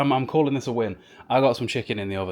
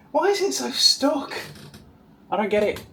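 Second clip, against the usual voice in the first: exasperated; 13 semitones higher than usual. Real, not acted. Frustrated at stuck bulb.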